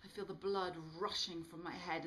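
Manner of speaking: usual speaking voice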